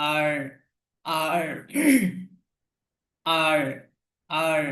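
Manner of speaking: "আর" with the final r audible; R sounded at end. Shown as a demonstration. Teacher drills R for learners, final r pronounced. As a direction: finish each "আর" on a light r. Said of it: "লাস্টে একটু র এর মতো".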